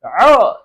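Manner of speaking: تعال with a loud ع squeezed from the throat; throaty Arabic ain. Shown as a demonstration. Teacher repeats تعال for students, ع squeezed from the throat. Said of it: "یہ آواز، عین کو کھینچے گلے سے".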